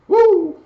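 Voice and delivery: short high whoop; exultant whoop. Real, not acted. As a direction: let out a high, loud "woo". Exults mid-sermon.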